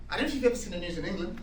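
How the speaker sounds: normal talking voice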